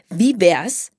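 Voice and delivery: eager, swooping question; excited. A narrator's reading. Sprotte proposes showing her friends a spot, excited. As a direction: pitch an idea with excitement. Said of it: "sagte Sprotte aufgeregt".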